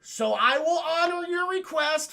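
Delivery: high, loud, drawn-out proclamation; hammed-up granting of a request; grandiose Predator.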